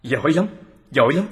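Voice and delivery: eager praise, pitched a little up; impressed praise. Acted. Listener praises the investigator for catching the gang, impressed and pleased. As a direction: congratulate him with rising enthusiasm.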